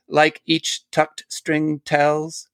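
each word plucked slowly, like strings; slow plucked reading. Shown as a demonstration. Reading a poem to a guest, plucking each word slowly. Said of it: "you can hear him plucking strings there".

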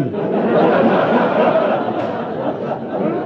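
real burst of laughter at the punchline; high breathy laugh breaking out. Real, not acted. Preacher laughing at the lifeboat punchline, real laugh.

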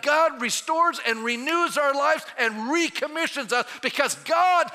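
swelling sermon climax, loud and high; preaching at full force. Acted. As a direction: drive it home to the congregation.

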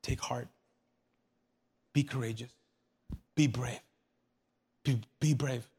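soft, low, measured reassurance; gentle encouragement. Acted. Jesus telling his people to be brave, gently encouraging. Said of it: "let me tell you what I, I did say".

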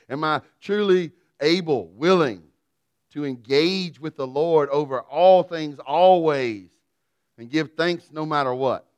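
preacher pressing the church to give thanks; full-force preaching. Acted. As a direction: build the challenge to the congregation. Preaching climax.

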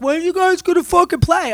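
put-on drunk uncle. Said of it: "drunk uncle is like".

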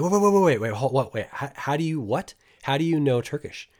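high, stammered double take; acted astonishment. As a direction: imitate someone stopped short in disbelief. Shop owner stunned by his Turkish.

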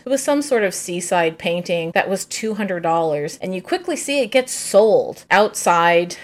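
usual speaking voice

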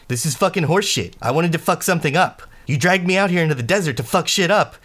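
furious high-pitched rant; angry yelling. Acted. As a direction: yell it, fed up and furious. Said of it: "she's like".

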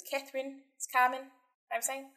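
Kiwi accent, syllables crushed at the end; New Zealand accent, rising and squashed endings. Shown as a demonstration. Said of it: "crushes all the syllables together at the end".

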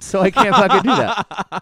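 laughing. Real, not acted. Laughing at his own joke.

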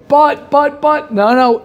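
high-pitched mock objection; protesting. Listener objecting to "it's always you", protesting.

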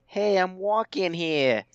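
put-on slow, drawn-out New York street accent; a cabbie's New York "hey". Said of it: "did you ever hear anybody say".

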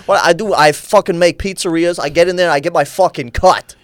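put-on a Minecraft kid talking like a wiseguy; kid gangster voice. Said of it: "that gangster voice".

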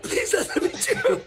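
high, strained mock prayer breaking into laughter; laughing mock plea. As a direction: beg jokingly, dissolving into a laugh.

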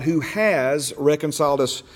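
"has" stretched out and stressed; slow down and lean on "has"; emphasis on HAS. Real, not acted. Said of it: "put emphasis on the words".

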